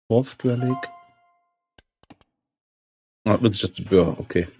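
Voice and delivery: usual speaking voice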